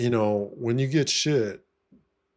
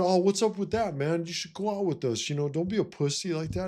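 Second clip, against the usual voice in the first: much higher, taunting voice of the other guy; mocking golf buddy; 9 semitones higher than usual. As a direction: imitate the guy needling him to go wheeling. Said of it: "he said".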